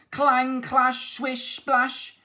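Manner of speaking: high, loud punchy sound words; vivid battle noises. A narrator's reading. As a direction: make the clashing blades sound out.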